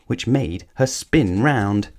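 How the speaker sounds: ordinary delivery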